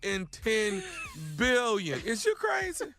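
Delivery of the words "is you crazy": laughing disbelief. Real, not acted. Laughing incredulous.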